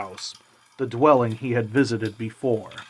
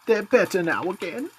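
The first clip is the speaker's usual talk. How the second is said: higher, gentle mother's voice; mother hiding her grief behind a higher voice; 8 semitones higher than usual. A narrator's reading. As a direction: voice the tired mother, lifted high. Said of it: "said Cratchit's wife".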